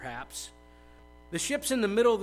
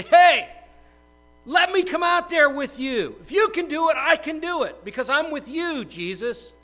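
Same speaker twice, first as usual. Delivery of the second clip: loud, high shout across the storm; a bold, pleading shout; calling out; 10 semitones higher, 10 dB louder than usual. Acted. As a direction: call out to Jesus over the wind. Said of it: "calling out".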